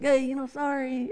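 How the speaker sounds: high, soft apologetic voice; a meek little apology; sheepish apology. Retold or imitated. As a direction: say sorry sheepishly to the other driver. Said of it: "It's like".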